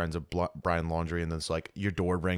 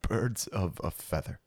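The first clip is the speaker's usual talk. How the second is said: slow, deliberate retake of Birds of a feather; slow and careful; 4 dB quieter, slower than usual. Shown as a demonstration. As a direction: say Birds of a feather slowly and clearly. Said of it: "You wanna try again?".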